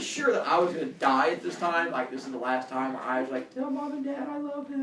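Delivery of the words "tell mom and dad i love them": mock dramatic farewell. Mock last words.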